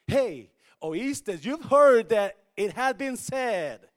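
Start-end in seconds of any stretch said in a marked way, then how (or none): none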